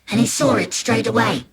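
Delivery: plain reading voice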